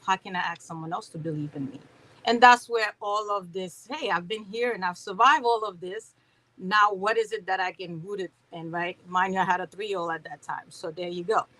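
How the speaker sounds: ordinary talk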